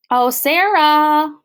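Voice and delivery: high, slow, loud gush. Acted. Principal greeting Sarah at the car, gushing. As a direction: gush over her name. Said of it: "Missus Burton gushed".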